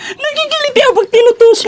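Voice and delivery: high giggly squeal; being tickled. Real, not acted. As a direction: laugh it out while poked. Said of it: "ang ganda mo naman tumawa".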